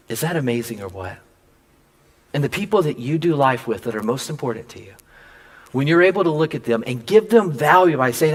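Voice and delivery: ordinary talk, nothing marked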